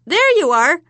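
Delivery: high, loud triumphant hag; triumphant witch. Acted. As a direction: proudly crow the result. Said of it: "she said triumphantly".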